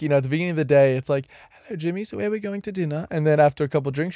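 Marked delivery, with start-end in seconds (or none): 1.7-3.1 quick, light and soft prim greeting; lightly voiced polite wife, sweet and proper early in the day; a hint of a prim wife, soft and quick. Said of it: "I always do the joke where it's like".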